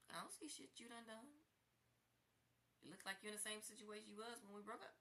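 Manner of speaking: imitating his dismissive attitude; mocking paraphrase. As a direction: sneer it out as if he said it. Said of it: "That's the way I took that".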